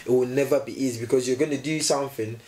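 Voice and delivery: normal talking voice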